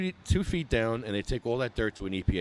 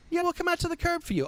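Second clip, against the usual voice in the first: high, dismissive put-on voice of a brushed-off shop owner; mock dispensary owner; faster than usual. Dispensary owner waving off the ramp offer, high mock voice. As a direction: squeak out the excuse in a high mock voice. Dispensary reply acted again.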